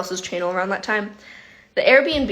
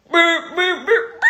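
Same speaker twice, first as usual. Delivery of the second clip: high-pitched honking beeps; amateur car horn, voice jumping way up; car horn impression; 8 semitones higher than usual. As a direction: imitate a car horn, not very convincingly. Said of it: "not a great impression of a, a car".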